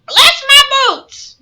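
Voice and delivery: very high, loud, drawn-out squawk; astonished parrot. A narrator's reading. Parrot whose tail was pulled, astonished squawk. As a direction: cry it out slowly like a startled parrot. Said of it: "utter an astonished croak and call out".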